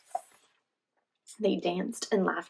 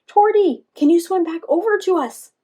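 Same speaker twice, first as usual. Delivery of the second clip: loud, high call across the water; shouted call, much higher and louder; 10 semitones higher, 10 dB louder than usual. A narrator's reading. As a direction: call to a friend far off on the pond. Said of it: "Joy called out".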